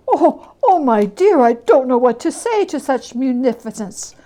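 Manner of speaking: lost for words with gratitude; overwhelmed gentleman. Acted. The gentleman to Scrooge on his generosity, overwhelmed.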